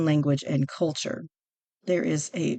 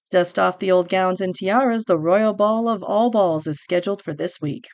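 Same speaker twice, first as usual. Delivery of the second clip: newsreel read; 5 dB louder than usual. Acted. Host reading the prince article aloud, newsreel announcer style. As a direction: announce the story like a vintage newsreel.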